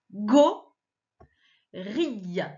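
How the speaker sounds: "go", long pause, "rille"; syllable by syllable. Shown as a demonstration. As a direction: break "gorille" into two separate syllables. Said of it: "je te montre les syllabes". (« go… rille », syllabe par syllabe)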